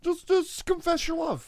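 high, hammed-up lovesick plea; high mock romantic voice. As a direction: plead for love in a high silly voice. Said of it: "They're like".

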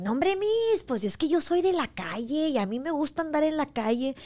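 put-on high, lilting kid's voice; imitating a boy who loves the street; mock street kid. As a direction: toss it off like a carefree street kid.

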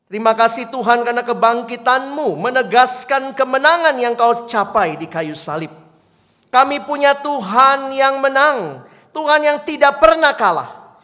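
loud, high, driving prayer; preacher closing the service in loud prayer; fervent prayer. Acted. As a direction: pray it out with full force. Closing prayer at full force.

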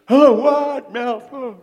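the cocky frog shouting its greeting; boastful frog. Acted. Preacher playing the frog meeting the elephant in a high, loud squeaky voice.

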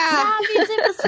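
laughing joke. Acted. Joking wordplay, laughing.

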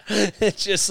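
loud high burst of laughter that trails into words; big laugh. Real, not acted. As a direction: crack up hard, can barely start the sentence. Cracking up at own story.

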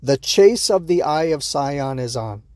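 slow, deliberate "Eye of Sion"; measured second attempt at a tongue-twister; careful retry. Real, not acted. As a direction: get through "Eye of Sion" slowly, word by word. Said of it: "That's a tough sentence to say".

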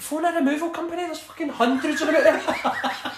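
high, loud and breaking into laughter; laughing exasperated retort. Retold or imitated. Telling Doyley to just hire movers, a laughing disbelieving shout. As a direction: yell it at him in disbelief while cracking up.

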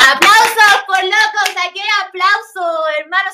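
thrilled, slowed bragging; excited boast. Real, not acted. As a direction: crow about your win. Bragging about applause.

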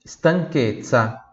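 Stanchezza with a hard k sound, stan-KET-tsa; Italian CHE as K. Shown as a demonstration. Teacher models Stanchezza for Brazilian students, slow hard k. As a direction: say Stanchezza slowly, chez as ket. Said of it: "C H E, tem som de Q".

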